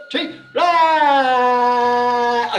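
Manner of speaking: loud energetic shout of rah; roaring rah. Shown as a demonstration. Coach roars to energize the class, loud shout. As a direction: let out a big rah on three. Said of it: "make a sound to put some energy into it".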